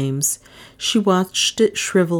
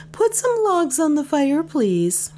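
higher, pitched for listening ears; said loudly; 12 semitones higher than usual. A narrator's reading. Dale speaking for listening ears, loud and high. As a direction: put on a show for eavesdroppers. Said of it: "she said loudly for the benefit".

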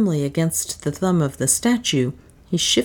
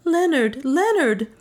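higher, urgent call of a name; urgent high cry to Leonard; 9 semitones higher than usual. A narrator's reading. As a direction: call to him across the temple. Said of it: "cried Juana".

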